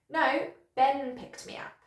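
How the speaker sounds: "Ben" stressed to correct the name; strong "Ben", the rest of the correction lighter; corrective stress. Shown as a demonstration. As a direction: punch "Ben" as the right person. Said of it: "use sentence stress to correct somebody".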